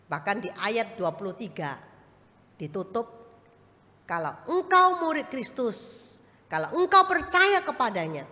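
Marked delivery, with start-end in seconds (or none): 4.1-8.2 forceful preaching. Acted. Preacher quoting verse forcefully.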